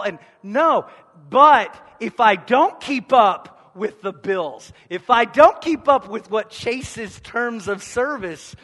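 high, loud, driving sermon delivery; full-force preaching. Acted. Pastor pressing the point on his congregation, preaching loudly. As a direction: preach it hard to the back row.

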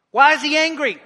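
ordinary delivery

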